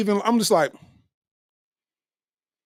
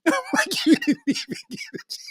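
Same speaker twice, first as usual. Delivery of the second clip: much higher, squeaky laughing voice; laughing squeal; 4 dB quieter than usual. Real, not acted. Mock self-scolding while laughing.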